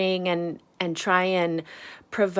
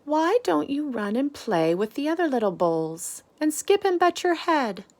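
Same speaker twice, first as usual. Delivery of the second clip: higher, coaxing mother voice; mother cow; 9 semitones higher than usual. Acted. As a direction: coax him like a worried mom. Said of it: "she would say".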